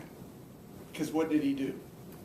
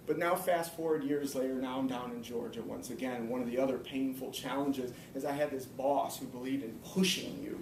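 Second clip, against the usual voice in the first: ordinary delivery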